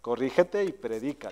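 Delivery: low, quiet voice of God; God answering. Retold or imitated. God replies to the preacher's doubt, quiet low correction. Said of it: "Y Dios me responde y dice".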